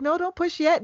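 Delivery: high urgent mock doctor; imitating the doctor telling her to wait; mock doctor's warning. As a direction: squeak out the warning. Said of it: "she was like".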